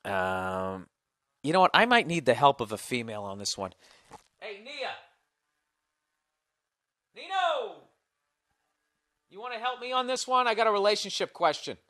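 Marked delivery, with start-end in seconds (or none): 4.4-11.8 high-pitched call, away from the mic. Real, not acted. Host calling girlfriend for help on a question, calling out. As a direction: call into the next room, voice lifted. Calls girlfriend in from another room.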